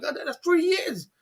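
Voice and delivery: high, slowed, theatrical groan of disbelief; mock exasperated sigh. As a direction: play the fed-up onlooker, hammed-up. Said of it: "It's like".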